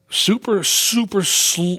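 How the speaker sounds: stretching super slowly; slow, dragged demo of slow music; drawn out. Shown as a demonstration. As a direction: drag the word out to show slow. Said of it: "like, slow".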